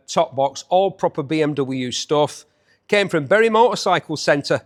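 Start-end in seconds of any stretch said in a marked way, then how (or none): none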